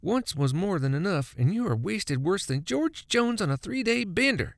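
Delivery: chiding wife. A narrator's reading. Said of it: "she chided".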